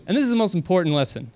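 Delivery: ordinary talk, nothing marked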